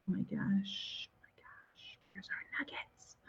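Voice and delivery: hushed suspense whisper. Real, not acted. Suspense while picking winner.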